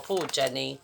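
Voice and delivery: usual speaking voice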